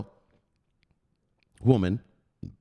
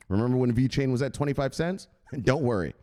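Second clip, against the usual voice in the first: ordinary delivery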